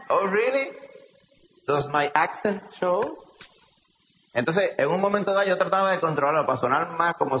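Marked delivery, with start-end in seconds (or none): 0.1-3.2 put-on slow English with a heavy Puerto Rican accent; mock-innocent question in Puerto Rican-accented English. Preacher answers someone spotting his origins, Puerto Rican accent. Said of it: "con el acento puertorriqueño".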